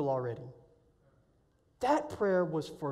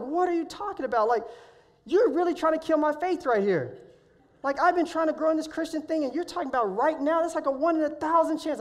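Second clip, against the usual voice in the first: higher and louder, disbelieving rant; incredulous inner protest; 8 semitones higher, 5 dB louder than usual. Retold or imitated. Son silently protests his dad's eagle prayer, loud disbelief. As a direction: push back in your head, loud and high.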